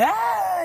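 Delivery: high-pitched mimicked kid's shriek; imitated child's scream. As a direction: imitate a kid screaming in a fit. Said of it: "I'll hear a scream".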